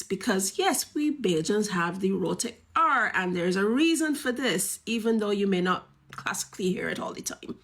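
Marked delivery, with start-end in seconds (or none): none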